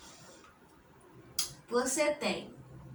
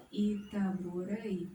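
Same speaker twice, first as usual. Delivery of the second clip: reading the sign; 4 semitones lower, 4 dB quieter, much slower than usual. Shown as a demonstration. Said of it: "Olha só".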